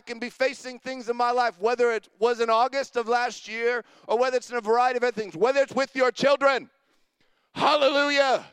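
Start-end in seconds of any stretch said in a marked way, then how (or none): none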